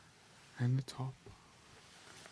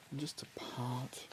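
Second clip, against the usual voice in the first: whispering; 4 dB quieter than usual. Acted. Soft-spoken scalp check roleplay.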